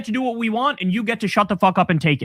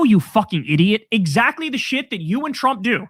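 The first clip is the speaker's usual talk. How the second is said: ordinary delivery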